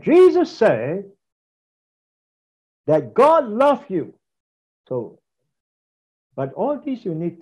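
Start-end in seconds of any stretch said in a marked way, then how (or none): 0.0-4.1 raised, drawn-out pulpit proclamation; rich preaching voice. Shown as a demonstration. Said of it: "and it become rich".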